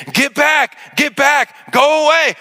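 put-on father screaming at kids to get back, high frantic yell. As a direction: scream them back to the house. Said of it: "I'm screaming at my kids".

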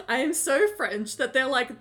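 ordinary talk

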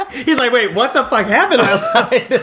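high laughing confused quote. Retold or imitated. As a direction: laugh through the question. Laughing quoting Andre.

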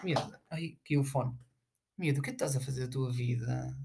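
quiet. Real, not acted. Scolding the girl softly.

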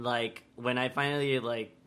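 ordinary talk, nothing marked

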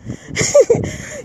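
a short, airy unvoiced laugh; breathy laugh. Real, not acted. Ranting at viewers, a quick breathless laugh. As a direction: laugh under your breath at them.

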